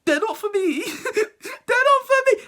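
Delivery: very high, half-laughing wail; hammed-up terrified child; mock panicked kid. Panicking kid insisting, crying laugh.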